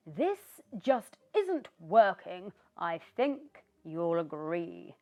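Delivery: loud, threatening witch; glaring witch. Acted. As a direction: menace him with every word. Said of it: "glared down at Christopher".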